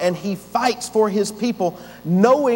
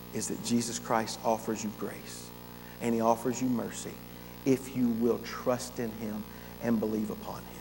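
softer, tender closing appeal; gentle invitation; 8 semitones lower, 8 dB quieter than usual. Acted. Pastor inviting unbelievers to trust, soft and tender. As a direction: draw them in quietly, lovingly.

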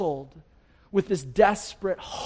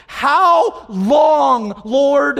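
loud, high drawn-out plea; crying out to God; 7 semitones higher, 11 dB louder, much slower than usual. Acted. Psalmist crying out to God in hopelessness, loud anguished cry. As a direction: call out each word long and strong. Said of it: "he's just crying out to God".

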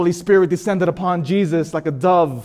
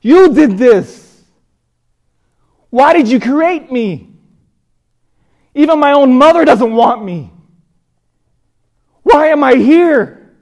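high, anguished full-voice yell; yelling at God; 10 semitones higher, 11 dB louder, slower than usual. Retold or imitated. Unwanted child blaming God for creating him, yelling. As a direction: cry out loudly, drawing out the accusation. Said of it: "I started yelling at God".

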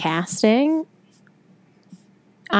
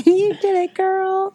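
cheering echo; 12 semitones higher, slower than usual. Acted. Co-host echoes the cheer.

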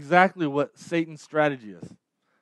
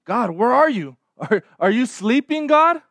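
rising into a pleading near-shout at the last word; desperate cry; 7 semitones higher, 5 dB louder than usual. Acted. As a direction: build from a plea to a loud desperate call on God. Doubter crying out to God.

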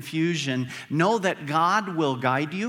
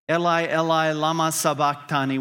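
quoted cry in a raised voice; crying out loudly; 7 dB louder than usual. Retold or imitated. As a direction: call it out louder, as a retold cry. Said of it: "Jesus cried out in a loud voice".

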